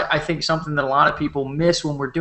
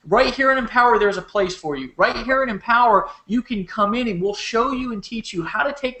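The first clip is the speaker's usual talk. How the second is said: raised-pitch, impassioned sales rally; motivational rant; 5 semitones higher than usual. Real, not acted. Leader urging listeners to join Empower, fired-up pitch. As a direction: drive it forward, punching the key words.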